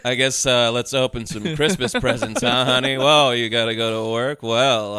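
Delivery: creepy family man. Retold or imitated. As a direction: play the unsettling husband at home. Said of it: "scene at the beginning ... with his family".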